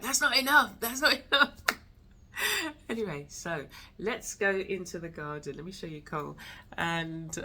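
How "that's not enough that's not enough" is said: high, louder whiny chick voice; voicing chicks after feeding, high and indignant; greedy baby bird. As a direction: complain like a never-full chick. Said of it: "it's just like".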